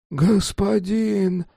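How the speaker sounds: high, slow frightened whine; whimpering. Acted. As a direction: whimper it out in terror. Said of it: "проскулил он".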